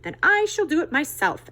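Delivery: high, loud determined hen; plucky little hen; resigned hen. Acted. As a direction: say it like a hen who gives up asking. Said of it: "answered the good little red hen".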